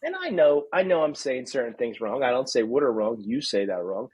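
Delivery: usual speaking voice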